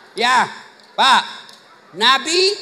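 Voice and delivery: ordinary talk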